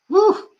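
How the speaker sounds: high loud whoop; excited woo. Real, not acted. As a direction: let out a joyful whoop. Sudden exclamation.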